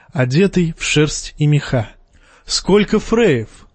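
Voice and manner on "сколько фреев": higher, slow mocking exclamation; exclaiming. A narrator's reading. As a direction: exclaim it with dry surprise. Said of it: "воскликнул Джейме".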